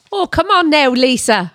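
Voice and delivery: high Irish accent; Irish mum. Shown as a demonstration. Imitating her mum on request, Irish accent. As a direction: coax in a high Irish lilt. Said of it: "something that your mum would say".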